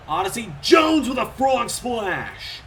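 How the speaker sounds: frog splash called out high and slow; excited call. Real, not acted. Commentator hyping the frog splash, excited call. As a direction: sell the big dive to the crowd. Commentator calls a big move.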